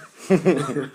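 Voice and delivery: bursting into loud real laughter; big laugh. Real, not acted. Caught out with a fake accent, a loud real laugh. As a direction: laugh out hard at your own blunder.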